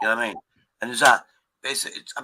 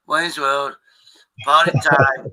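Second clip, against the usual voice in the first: higher, loud imitated catchphrase; mock TV catchphrase, punched louder at the end; Wayne's World catchphrase; 5 semitones higher, 10 dB louder, slower than usual. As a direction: call it out like the sketch show's slogan.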